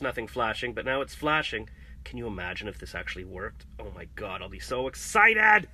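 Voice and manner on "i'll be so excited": excited. Real, not acted. Imagining the modem working.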